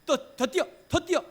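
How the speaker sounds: high, loud mock drill-sergeant yelling; harsh driving God. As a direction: push them on like a harsh coach, shouting. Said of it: "막 그렇게 하실까요, 하나님이?".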